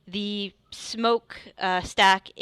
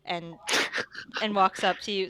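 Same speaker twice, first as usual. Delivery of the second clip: ordinary delivery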